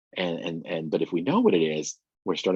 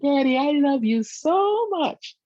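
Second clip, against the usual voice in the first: put-on much higher, slow, sweet little-boy voice; son's small high voice; 5 dB louder, slower than usual. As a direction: imitate a small child sweetly saying he loves dad. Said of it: "in his small, high voice".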